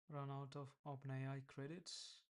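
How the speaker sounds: ordinary talk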